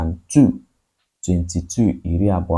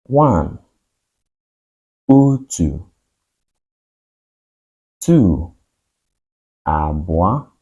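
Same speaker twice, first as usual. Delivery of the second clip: counting drill; slower than usual. Shown as a demonstration. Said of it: "Let's go to class".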